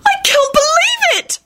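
very high, tearful squeal of joy; tearful enthusiasm. A narrator's reading. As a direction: squeal it, overcome with happiness. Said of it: "Rosie enthused as tears sprang up".

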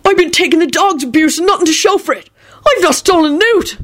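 high, plaintive protest; frantic. A narrator's reading. Accused thief pleading innocence, frantic. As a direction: insist on innocence, voice way up.

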